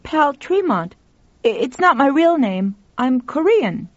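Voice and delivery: put-on high-pitched boy's voice; young boy. A narrator's reading. Boy explains his name to the woman, high young voice. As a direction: answer as an earnest boy, voice lifted high.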